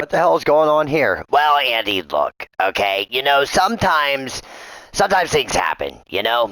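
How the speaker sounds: ordinary talk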